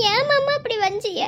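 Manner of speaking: usual speaking voice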